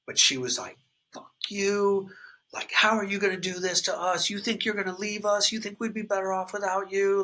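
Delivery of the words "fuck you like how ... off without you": higher-pitched, mock furious retort; imitating his wife's furious disbelief; retold furious wife. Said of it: "she was furious".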